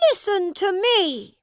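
put-on a young prince's high, loud command; shouted. Prince shouts at the mocking schoolboys, loud and high. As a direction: shout to be heard over the boys. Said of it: "shouted the prince".

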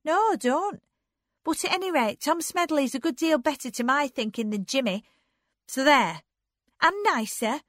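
high, loud, argumentative sister; defiant Anne. A narrator's reading. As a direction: argue back in a high insistent voice. Anne argues back defiantly.

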